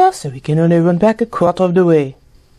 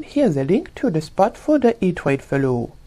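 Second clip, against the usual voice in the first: ordinary delivery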